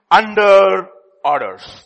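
ordinary talk

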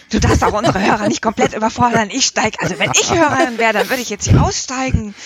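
mildly exasperated, pitch lifted in complaint; lively re-enacted telling-off; retold scolding. Editor re-enacting her one scolding of the author, mildly exasperated. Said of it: "einmal habe ich tatsächlich geschimpft".

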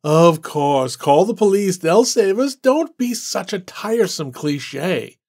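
mock her idea with a loud sarcastic groan; sarcastic scorn. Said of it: "Randall groaned".

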